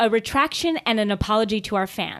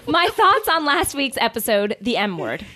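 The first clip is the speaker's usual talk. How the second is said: ordinary delivery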